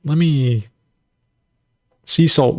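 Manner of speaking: ordinary talk, nothing marked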